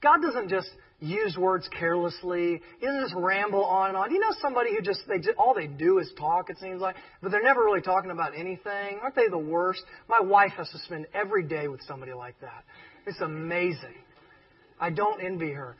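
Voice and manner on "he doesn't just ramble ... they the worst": ordinary talk, nothing marked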